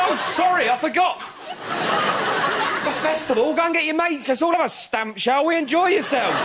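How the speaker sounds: drip sarcasm onto every word; sarcastic. Retold or imitated. Woken camper mocking the stamping ravers, sarcastic. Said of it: "I said".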